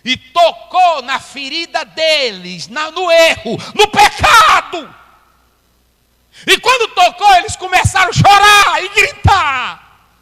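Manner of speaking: rising to a full shout, slowed; fiery preaching. Acted. Preacher to congregation, building to a shout.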